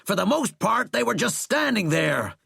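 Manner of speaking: high, heated, loud tirade; loud argument. A narrator's reading. General telling the president their attack failed, loud. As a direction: argue at full pitch, pressing each point. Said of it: "Sheckley said loudly".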